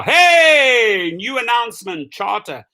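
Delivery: shouting out the announcement like a herald; a hammed-up corporate announcer; mock company announcement. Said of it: "Here they are".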